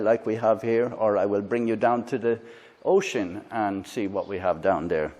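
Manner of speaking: normal talking voice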